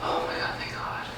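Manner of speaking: faint whisper of relief. Real, not acted. Whispering to viewers while Justin is asleep, very faint whisper. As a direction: whisper it, barely audible. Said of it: "I'm whispering right now".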